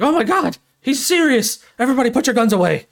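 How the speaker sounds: high, loud alarmed townsfolk; mock panic. Townsfolk believing the hostage threat, mock panicked shouting. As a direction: cry out in hammed-up alarm.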